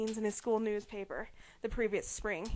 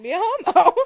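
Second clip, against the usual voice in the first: louder, giggling delivery; laughing at the punchline; 7 semitones higher, 16 dB louder than usual. Real, not acted. Reader laughing at Jason's reasoning. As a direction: crack up on the logic.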